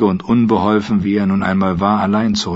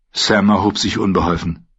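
ordinary delivery

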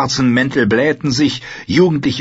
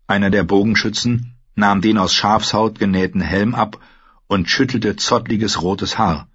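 plain reading voice

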